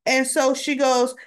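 ordinary talk, nothing marked

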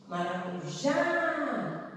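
Maracujá with a long held JÁ; stress on last syllable. Shown as a demonstration. Teacher showing the last syllable is strongest, JÁ stretched. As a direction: draw out the final "já" of "Maracujá". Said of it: "última sílaba".

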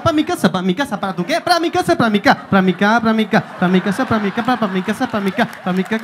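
rhythmic, chanting Brazilian repeats; dance the words like samba. Retold or imitated. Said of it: "baila cuando habla". (acento brasileño rítmico, repetido)

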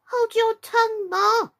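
put-on high, loud young crab; snappish. A narrator's reading. Young crab silencing her mother, snappishly. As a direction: snap back in a high child's voice. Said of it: "said the little crab a little snappishly".

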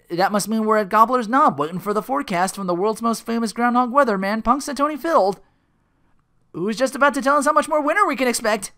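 high, loud, overblown broadcast announcing; TV reporter. Retold or imitated. Phil reporting live from Gobbler's Knob, loud announcer voice. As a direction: project it like a hammed-up live report. Phil's on-camera forecast report.

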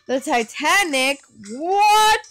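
high, loud exaggerated disbelief; mock shock. Player kidding about the Titanic, mock shock. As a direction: build to a loud, high, fake "what".

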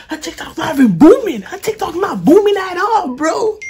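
high, loud mocking jeer. Real, not acted. Teasing a sister about her TikTok, high jeer.

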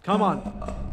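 ordinary talk

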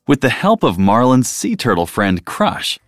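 ordinary delivery, nothing marked

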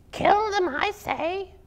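a vicious spider demanding the kill; hissing spider. Acted. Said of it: "hissed a fourth".

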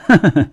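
high, loud scornful chuckle; laughing in rage. A narrator's reading. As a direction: burst into a bitter, furious laugh. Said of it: "唐庆怒极反笑".

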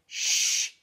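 loud, sharp "shh"; scolding shush. A narrator's reading. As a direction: hush someone crossly. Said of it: "Darcus scolded".

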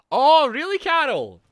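loud, high, indignant retort; mock outrage. Real, not acted. Streamer answering Carol's gameplay tips, mock outrage. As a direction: shout it back in exaggerated protest. Reacting to viewer's backseat advice.

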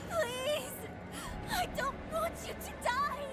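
very high, anguished cry; desperate pleading. Acted. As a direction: beg for their life in anguish. Daughter pleads with dying mother.

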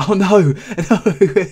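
laughing dismay at a loss; dismayed cry. Real, not acted. Spinosaurus dies.

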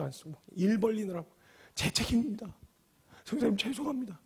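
subdued, soft confession; retold tearful apology. Humble pastor apologizing to the visiting preacher, understated tearful apology. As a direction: humbly take the blame, voice hushed with sorrow. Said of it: "눈물, 콧물을 질질 흘리면서".

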